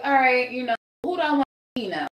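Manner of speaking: ordinary delivery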